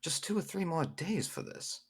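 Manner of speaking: high-pitched, courteous little gnome; gnome visitor. Acted. Gnome asking townsman about festival preparations, high and courteous. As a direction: inquire politely in a high voice.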